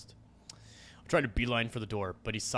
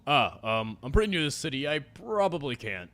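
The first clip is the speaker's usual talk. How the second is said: higher, louder, flustered; nervous stammer; 4 semitones higher, 5 dB louder than usual. Acted. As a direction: scramble for an excuse. Scared girl answers menacing stranger.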